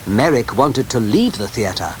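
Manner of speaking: ordinary delivery, nothing marked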